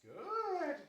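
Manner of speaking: high, loud praise; excited praise. Real, not acted. Trainer praises the dog in a high excited voice. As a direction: cheer her on.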